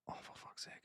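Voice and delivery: whispered exasperation; exasperated aside. Retold or imitated. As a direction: swear under your breath, fed up. Retelling exasperation at being misunderstood.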